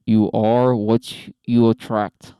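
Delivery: booming, very slow prompt read; announcer read. Pretend. Host announcing the debate prompt, booming slow read. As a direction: proclaim the topic like a game show.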